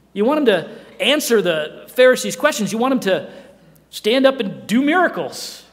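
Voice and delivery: loud, driving preacher's build; forceful preaching. Acted. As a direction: press the crowd's demands hard.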